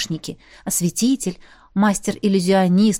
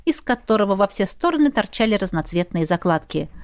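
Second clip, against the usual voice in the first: ordinary delivery, nothing marked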